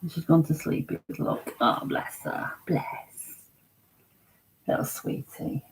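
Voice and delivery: hushed, near-whispered fondness; tender murmur, barely voiced; soft cooing. Real, not acted. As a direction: coo very softly over a sleeping pet. Cooing over a sleeping dog.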